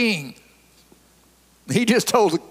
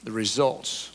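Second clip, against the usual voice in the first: lower, softer prayerful voice; 5 semitones lower, 3 dB quieter than usual. Real, not acted. Preacher asks God to teach the congregation, subdued prayer. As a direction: bring it down into earnest, subdued prayer.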